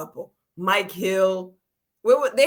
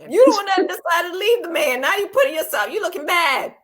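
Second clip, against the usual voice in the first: scolding; 9 semitones higher, 6 dB louder than usual. Retold or imitated. Scolding Cynthia directly.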